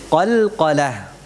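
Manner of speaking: Qalqalah slowly, with the q sounds bouncing; qalqalah bounced. Shown as a demonstration. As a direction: say Qalqalah slowly, echoing each q. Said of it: "Apa itu qalqalah?". (Qalqalah diucapkan pelan dengan pantulan)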